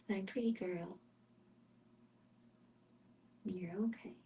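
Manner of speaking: whispered reassurance to the cat. Real, not acted. Owner soothing her cat, whispered. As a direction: softly whisper it's okay.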